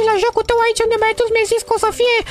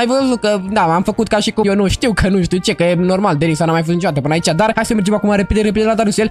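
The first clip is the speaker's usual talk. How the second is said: deep pitched-down aside. Acted. Girl whispering plans to viewers in a pitched-down voice. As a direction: voice dropped far down, like an edited secret aside.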